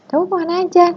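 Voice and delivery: a light, friendly ask, not overdone; a simple warm question; friendly, measured. Shown as a demonstration. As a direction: keep it easy and natural. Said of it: "Itu cukup".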